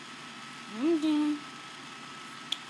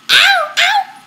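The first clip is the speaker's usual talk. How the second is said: much higher, very loud yelps of pain; real pained yelp; 14 semitones higher, 12 dB louder than usual. Real, not acted. Owner yelping in real pain as his dog bites him. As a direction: yelp as the bite lands hard.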